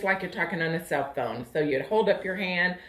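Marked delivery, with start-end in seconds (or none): none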